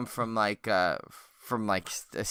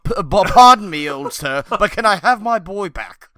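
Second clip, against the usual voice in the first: higher, louder overly polite posh voice; sophisticated gentleman; 9 semitones higher, 11 dB louder than usual. Pretend. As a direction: beg politely like a refined English gent. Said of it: "some really sophisticated guy".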